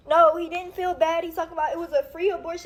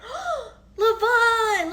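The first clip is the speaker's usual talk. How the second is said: slow, higher, tearful cry of his name; 5 semitones higher, much slower than usual. Retold or imitated. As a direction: let the name break into a sob. Crying over miscarriage blood.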